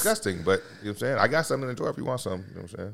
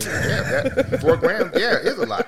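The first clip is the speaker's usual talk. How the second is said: laughing while talking; words through a laugh; 10 semitones higher, much slower than usual. Real, not acted. Laughing at four grams.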